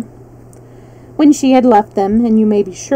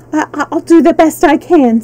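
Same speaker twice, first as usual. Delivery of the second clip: frightened stammer; 6 semitones higher, 3 dB louder than usual. A narrator's reading.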